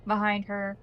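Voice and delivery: normal talking voice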